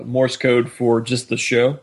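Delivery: usual speaking voice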